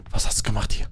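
whisper. Real, not acted.

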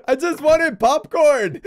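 slow, laughing mock wail; mock whine. Player speaking for his dead character, mock tearful whine. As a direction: draw it out in pretend misery.

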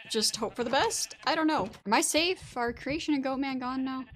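high loud nervous hiding; being chased. Real, not acted. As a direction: ask it in a jittery rush. Hiding from chasing mobs.

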